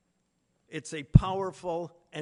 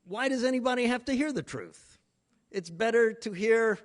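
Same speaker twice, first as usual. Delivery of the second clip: mock family protest; 7 semitones higher, 4 dB louder than usual. Voicing the family's objection.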